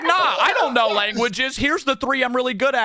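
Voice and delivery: mock modest friend. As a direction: parody his fake humility. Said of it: "you were like".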